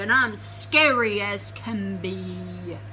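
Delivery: slow, loud, menacing boom; a big scary voice echoing from a hole; booming giant voice. Acted. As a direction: boom it out slowly like a hidden giant. Giant booms from the burrow.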